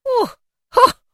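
loud, high, delighted discovery; giddy Scrooge pointing things out; excited cry. A narrator's reading. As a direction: exclaim it with a gleeful whoop. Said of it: "cried Scrooge".

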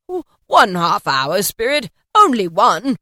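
put-on loud, slow begging old man; pleading Scrooge. A narrator's reading. Scrooge begging the spirit to stay, loud and pleading. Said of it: "said Scrooge".

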